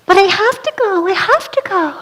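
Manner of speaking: put-on high, pleading as a girl. Daughter begging mother to let her go, high pleading.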